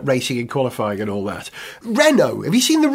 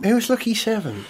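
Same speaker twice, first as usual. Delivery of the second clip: ordinary talk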